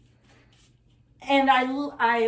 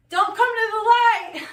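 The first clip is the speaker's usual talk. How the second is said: high, loud mock plea; mock warning cry; 9 semitones higher, 7 dB louder than usual.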